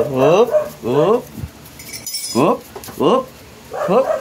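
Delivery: rising, stretched hop calls; urging jumps. Real, not acted. Getting the pet to hop, with rising coaxing calls. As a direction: coax a pet to jump, each word swooping up.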